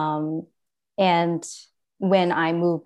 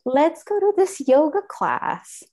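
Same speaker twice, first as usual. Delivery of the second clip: mom's suggestion; 10 semitones higher than usual. Retold or imitated. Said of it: "my mom's like".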